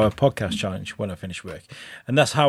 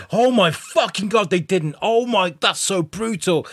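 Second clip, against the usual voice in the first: high-pitched, appalled disbelief; retold horror; 7 semitones higher than usual. Viewer seeing the children's corpses, acted-out horror. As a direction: react aghast, acting out a stunned viewer.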